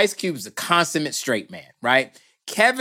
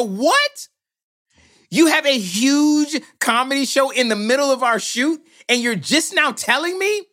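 outraged disbelief; 9 semitones higher than usual. Retold or imitated. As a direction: explode in disbelief at the news. Outraged producer.

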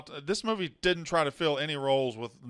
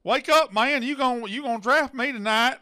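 put-on very Southern accent; 8 semitones higher, 5 dB louder than usual. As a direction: holler it in a thick Southern accent. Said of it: "the most Southern-sounding person in the world".

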